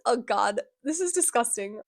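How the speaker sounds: ordinary talk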